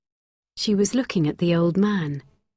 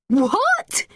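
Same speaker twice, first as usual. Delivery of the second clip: high rising what; boy shocked by the accusation; stunned; 13 semitones higher than usual. A narrator's reading. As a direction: react in disbelief.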